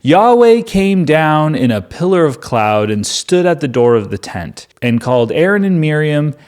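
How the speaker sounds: ordinary talk, nothing marked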